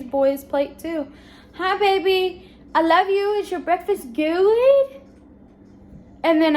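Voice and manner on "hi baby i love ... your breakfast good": high, sweet mommy voice, last word drawn out. Real, not acted. Mom greeting her baby at breakfast, high slow baby talk. Mother talks to her toddler.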